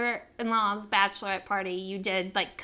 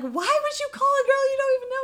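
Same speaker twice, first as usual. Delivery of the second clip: high, fast mock disbelief; incredulous friends; 14 semitones higher, 3 dB louder, faster than usual. Imitating friends questioning the blind phone call, incredulous. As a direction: squeal the question in exasperation.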